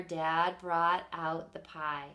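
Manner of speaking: ordinary delivery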